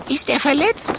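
excited. A narrator's reading. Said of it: "fragt der Zwergdrache aufgeregt".

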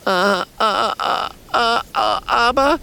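high, stuck stutter on a-. A narrator's reading. Trapper stutters a protest in high anxious bursts. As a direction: stammer before the aber comes out. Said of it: "stottert Bärentöter Bernd".

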